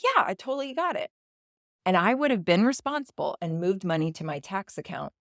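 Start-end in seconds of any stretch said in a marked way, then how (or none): 0.0-1.1 high, quick, breezy yes; airy, too-easy reassurance; breezy agreement. Retold or imitated. Breezily agreeing to husband's requests.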